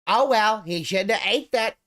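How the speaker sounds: high, loud careless shrug voice; mocking impression. Mocking a careless dad to the stream. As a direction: mimic him brushing it off, loud and high. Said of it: "He'd be like".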